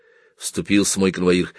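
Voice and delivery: ordinary delivery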